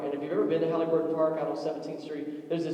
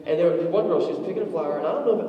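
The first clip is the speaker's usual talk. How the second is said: ordinary delivery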